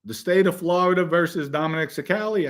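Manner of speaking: a hint of courtroom formality; judge. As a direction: imitate a judge calling the case. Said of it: "the judge says".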